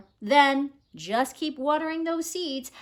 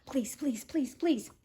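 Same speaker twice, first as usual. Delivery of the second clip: soft pleading; 8 dB quieter than usual. Acted. Parents begged, reading aloud.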